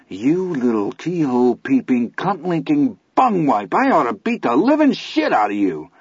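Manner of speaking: lyrical cursing. A narrator's reading. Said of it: "waxed positively lyrical".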